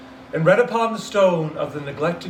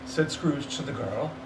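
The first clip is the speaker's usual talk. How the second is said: ordinary delivery, nothing marked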